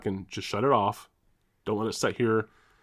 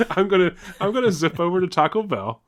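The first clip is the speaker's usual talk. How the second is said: breaking up into laughter mid-sentence; can't get it out for laughing; laughing through words; 8 semitones higher, 5 dB louder than usual. Real, not acted. Retelling his silly taco plan, cracking up.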